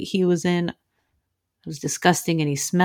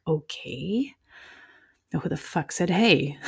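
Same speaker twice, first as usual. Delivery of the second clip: hushed; 7 dB quieter, slower than usual. Retold or imitated. Spooked, alone in apartment.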